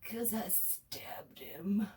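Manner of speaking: a slow, whispered confession; slow dark whisper. Retold or imitated. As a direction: hush it slowly like a grim secret. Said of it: "And he's like".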